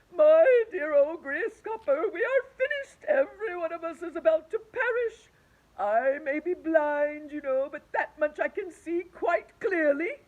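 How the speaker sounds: loud, high doomed lament; despairing wail. Acted. The earthworm wails to the grasshopper, loud and despairing. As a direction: wail that all is lost.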